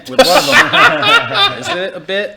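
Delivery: high laughing squeal. Real, not acted. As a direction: laugh through the words.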